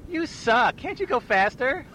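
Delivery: high, slow put-on girl voice; squeaky video game girl; Crazy Taxi girl. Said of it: "I'm gonna be the girl from Crazy Taxi".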